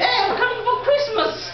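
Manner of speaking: excited announcement. Retold or imitated. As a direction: sing it out like the best gift. Retelling the call to girlfriend.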